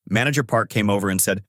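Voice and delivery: plain reading voice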